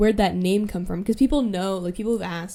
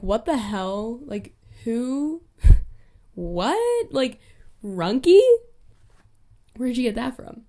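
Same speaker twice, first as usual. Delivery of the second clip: higher, baffled retelling of the moment; mock confusion; 4 semitones higher, 4 dB louder, slower than usual. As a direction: act out the friends' bewilderment. Retold confused reaction.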